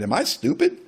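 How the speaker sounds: high, whining self-pity; mock indignant whine of a jealous friend; exasperated inner voice. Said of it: "I'm thinking".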